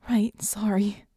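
small, stammered apology. A narrator's reading. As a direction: stammer it out, unsure. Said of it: "she stammered".

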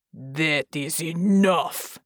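slow, heavy angry roar. Acted. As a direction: bellow it, each word dragged out. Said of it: "he roared at her".

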